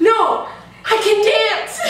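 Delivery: high, loud, slow, laughing insistence. Retold or imitated. Insisting to leader Amy.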